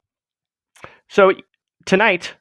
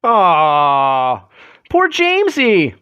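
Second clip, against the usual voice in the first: drawn-out cooing sympathy; slow, swooping aww of pity; mock pity; much slower than usual. Pitying the puppet after skit.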